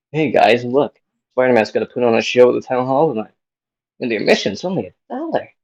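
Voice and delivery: excited announcing. Acted. Schoolboy telling friends about Spider-Man's show, loud and excited. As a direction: call your pals over, fast and loud.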